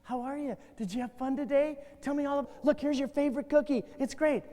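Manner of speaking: put-on soft, doting mom greeting the kid; a mother fussing sweetly over her son at the door; sweet parent voice. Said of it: "What happened when they came home?".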